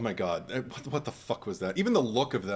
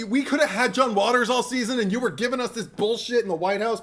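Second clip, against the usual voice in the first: higher, louder mock complaint; exasperated rant; 11 semitones higher, 5 dB louder than usual. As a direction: vent at the show, half laughing. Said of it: "and it's just like".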